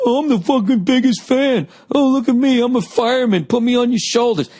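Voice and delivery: mock the painted-face fan; mocking superfan.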